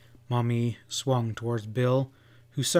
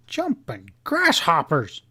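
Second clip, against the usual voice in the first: slow, louder surprised outburst; a cowboy's slow, startled oath; startled exclamation; 5 semitones higher, 4 dB louder than usual. A narrator's reading. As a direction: blurt it out in astonishment. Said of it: "ejected Bill".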